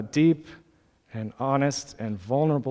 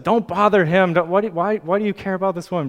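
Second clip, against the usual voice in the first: higher, louder imitated protest; retold bystander objection, raised voice; objecting; 5 semitones higher, 6 dB louder, faster than usual. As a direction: scold like an impatient bystander, stumbling over the question. Bystanders scolding, voiced by preacher.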